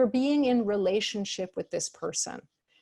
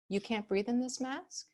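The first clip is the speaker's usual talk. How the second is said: soft, sweet questioning echo, much softer; gently mirror their words back as a question; sweet deference; 8 dB quieter than usual. Shown as a demonstration. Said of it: "sweetly, with that little tone of deference".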